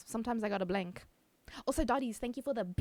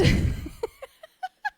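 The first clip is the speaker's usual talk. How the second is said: high laugh; 9 dB louder than usual. Real, not acted. Laughing at being released.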